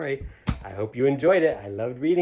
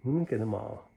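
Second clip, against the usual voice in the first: ordinary delivery, nothing marked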